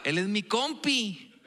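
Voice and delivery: "compi" dicho lento y suave; tono cariñoso de compi. Shown as a demonstration. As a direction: alarga "compi" con cariño. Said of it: "Él no es mi compa". ("compi" dicho con diminutivo cariñoso)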